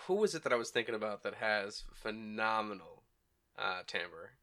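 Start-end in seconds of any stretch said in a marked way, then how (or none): 3.9-4.3 "timbre" said softly as TAM-ber, questioning; low, soft try at "timbre" as TAM-ber. Shown as a demonstration. Cohost testing the word, "timbre" as TAM-ber.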